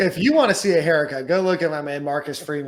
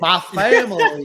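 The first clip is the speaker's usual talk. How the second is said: slow, higher, laughing mock Southern "My family"; mock Southern accent; 4 semitones higher, 4 dB louder, slower than usual. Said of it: "nobody talks like that except for, like, Forrest Gump".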